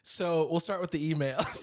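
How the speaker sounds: a second go at the DJ voice; morning show voice. Acted. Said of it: "let me give it another go".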